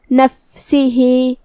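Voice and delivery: slow نفسه, airy fa before the seen; whispered fa. Shown as a demonstration. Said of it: "ہمیں فا کو ہمزے سے پڑھنا ہے".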